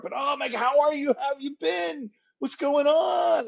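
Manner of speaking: very high, gushing imitation of a friend; mock-thrilled squeal, stretched out at the end; effusive greeting. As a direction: gush high, acting out an overjoyed hello. Retelling James's effusive greeting.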